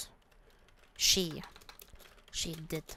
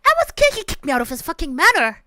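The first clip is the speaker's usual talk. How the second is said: outraged; 5 semitones higher, 5 dB louder, much faster than usual. Real, not acted. Outraged after being kicked out.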